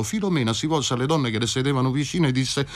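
ordinary delivery, nothing marked

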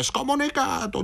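high, sharp mock yell of outrage; shouted. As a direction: hurl the insult at him. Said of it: "gli gridarono".

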